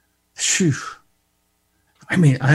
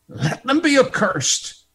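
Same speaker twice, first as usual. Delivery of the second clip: higher, ringing declaration; proclaimed curse; 10 semitones higher, 3 dB louder than usual. Acted. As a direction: announce the curse to the crowd. First, proclaimed take of curse.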